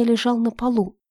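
plain reading voice

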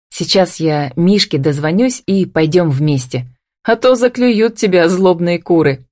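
ordinary delivery, nothing marked

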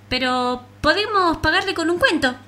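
high, loud hopeful pitch; eager offer. Acted. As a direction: pitch the idea brightly. Flea's bold offer.

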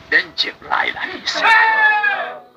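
high, slow, loud proclamation; proclaiming shout. Acted. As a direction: announce the angel's name with full force. Angel announces himself.

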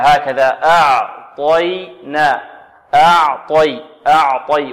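أعطي with thin ع and ي, heavy emphatic ط; demo of أعطي. Shown as a demonstration. Teacher models أعطي for students, heavy ط. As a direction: model أعطي slowly, ط full and dark. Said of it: "نرقق العين ونفخم الطاء ونرقق الياء".

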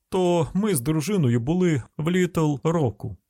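grumbling hillbilly car owner; rustic householder. Acted.

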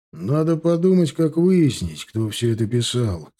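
plain reading voice

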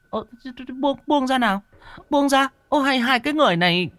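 high fast irritated woman. Acted. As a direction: push them off, exasperated. Woman shoving off clinging men.

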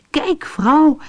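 high, eager cry to his wife; thrilled, showing her his find; excited call. A narrator's reading. Rashid shows his wife the egg, excited. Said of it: "riep hij opgewonden".

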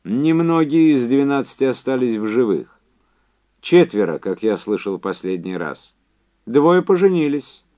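high, loud, emphatic caller; Quincy's phone voice. Acted. Quincy telling Andy about the test survivors, high and insistent. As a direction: push it up high and project.

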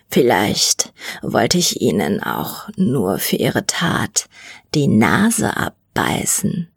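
very deep, slow, half-whispered threat; deep creeping monster whisper; menacing mutant. A narrator's reading. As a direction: lean in and savour the menace.

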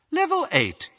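cool lift announcement voice; detached recorded witch voice; cool announcer. A narrator's reading. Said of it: "the witch's cool voice".

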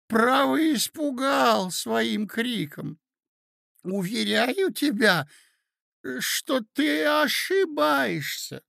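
put-on high, slow old prince's voice; drawn-out, high-pitched doddering pleading; befuddled old prince. Old prince calming his excited young nephew, befuddled.

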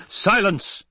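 high, explosive command; imperial roar. Acted. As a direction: roar to cut someone off. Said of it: "the Emperor roared".

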